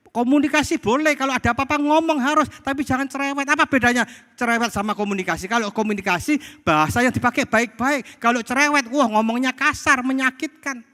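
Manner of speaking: high, emphatic exhortation; insistent preacher's delivery. Real, not acted. Preaches with raised voice.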